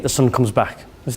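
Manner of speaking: ordinary talk, nothing marked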